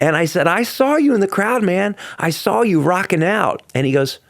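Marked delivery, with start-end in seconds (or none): 0.5-3.6 high, eager fan gushing; retelling his own excited gushing, high-pitched; excited praise. Retold or imitated. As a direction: gush up high like a starstruck fan. Retelling excited praise to [name].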